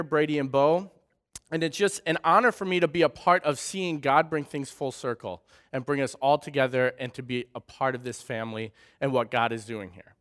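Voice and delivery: usual speaking voice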